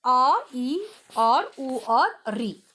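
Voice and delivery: each vowel said slowly and apart; slow vowel list. Shown as a demonstration. Teacher to children, listing the basic vowels slowly. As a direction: recite the four vowels slowly. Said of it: "यह चार हैं बच्चों".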